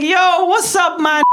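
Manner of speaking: high, loud call of "Yo, what's up"; loud greeting. Retold or imitated. Friend calling out loud to him on the street. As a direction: shout it out to him.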